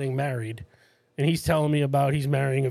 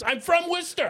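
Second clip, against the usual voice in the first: retold excited reply.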